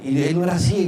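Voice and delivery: usual speaking voice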